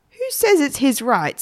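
put-on mildly cross boy demanding an answer; understated angry challenge; getting mad. A narrator's reading. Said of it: "getting quite mad".

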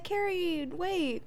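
high, drawn-out call without much volume; mock-call after someone running off. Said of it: "calling after".

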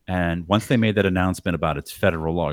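usual speaking voice

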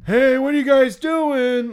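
drawn-out, high, loud foghorn holler; yelling drunk woman. Retold or imitated. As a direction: imitate a badgering drunk heckler. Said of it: "so she's yelling".

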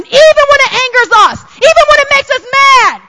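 building to a high shout; rising preacher cry; preaching shout. Real, not acted. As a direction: congregation urged at full force.

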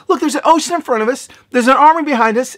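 high-pitched mock panic; imitating frightened complainers; freaking out. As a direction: act out a panicked crowd, high voice. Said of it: "they start to freak out".

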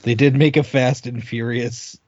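ordinary talk, nothing marked

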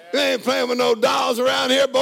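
loud, high, hammed-up father's order; mock stern shout. Dad shouting at his son about dolls, hammed-up and loud. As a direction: bark it like a tough dad, grinning.

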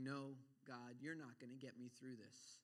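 low, soft voice of giving up on God; defeated refusal. Acted. Preacher voices someone failing the trial, defeated refusal. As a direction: say it quietly, hope gone.

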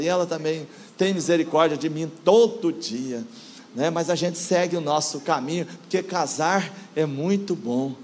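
usual speaking voice

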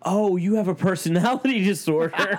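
high-pitched, amused dawning realisation; mock revelation. Retold mock revelation to the woman.

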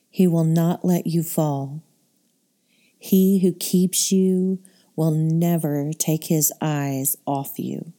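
ordinary talk, nothing marked

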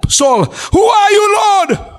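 high, drawn-out cry to the Lord; stricken Saul. Acted. Preacher acting fallen Saul asking who speaks, high cry. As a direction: call out in awed bewilderment, acted.

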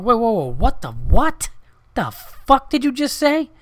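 high, loud incredulous whoa, pitch jumping up; shocked disbelief. Retold or imitated. Friend hears of a murder next door, stunned disbelief. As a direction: stop him cold in stunned disbelief.

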